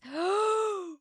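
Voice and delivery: high shocked gasp; a high, startled intake of breath; sharp gasp. Real, not acted. Finds Orlando date.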